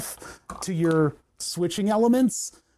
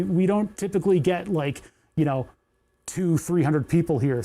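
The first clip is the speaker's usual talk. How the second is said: normal talking voice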